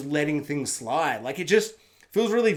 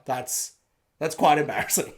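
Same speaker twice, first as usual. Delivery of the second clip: normal talking voice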